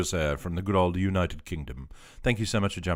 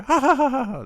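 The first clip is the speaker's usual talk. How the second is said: loud, high mock laugh; staccato high-pitched "ha" volley; imitated pig cackle; 10 dB louder than usual. Imitating game pigs' cry.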